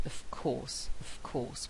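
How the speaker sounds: "of course" with the v turned into a strong f, "off course"; strong f sound. Shown as a demonstration. Teacher demonstrating assimilation in "of course". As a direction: let "of" end in a hard voiceless f. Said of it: "with a very strong f sound there".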